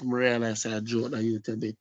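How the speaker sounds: ordinary delivery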